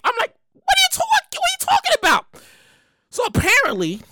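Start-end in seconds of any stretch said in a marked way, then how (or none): 0.5-2.2 high-pitched, baffled comeback; incredulous reply. Retold or imitated. Answering someone nagging about a forgotten gift, incredulous and high-pitched. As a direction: shoot back in a high voice, completely thrown. Acting out puzzled reply to Boo Boo.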